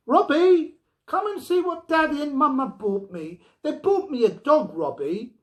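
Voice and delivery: put-on high, loud excited child's call; shouting. A narrator's reading. Girl shouting down the basement steps to Robbie, high and loud. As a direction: shout it as you run down the stairs. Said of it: "shouting as she went".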